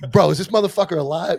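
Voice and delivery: retold shocked question to the lieutenant; alarmed disbelief. Retelling alarmed reaction to lieutenant.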